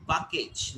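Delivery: "package" said "pah-kage"; Indian pronunciation. Shown as a demonstration. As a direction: open "ah" in the first syllable of "package". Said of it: "they pronounce package".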